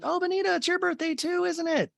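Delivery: very high-pitched sing-out; tipsy gushing. Retold or imitated. Host imitating a tipsy guest hugging and greeting, gushing.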